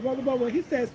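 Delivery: usual speaking voice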